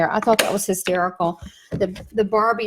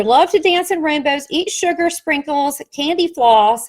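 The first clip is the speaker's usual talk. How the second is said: whimsical storybook reading; 5 semitones higher, 3 dB louder than usual. Acted. Reads unicorn story aloud.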